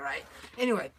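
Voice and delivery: ordinary talk, nothing marked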